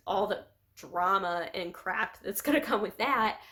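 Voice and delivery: usual speaking voice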